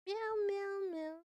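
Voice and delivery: sing-song kitty meows; cat meows. Pretend. Playful cat noise to herself.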